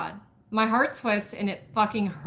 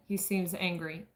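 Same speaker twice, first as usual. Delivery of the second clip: plain reading voice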